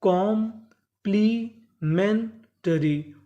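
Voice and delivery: Complementary in four slow chunks, कॉम-प्ली-मेन-टरी; very slow teaching breakdown of complementary; syllable by syllable. Shown as a demonstration. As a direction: break complementary apart with clear pauses. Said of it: "टुकड़ों में तोड़ तोड़ के पढ़ें".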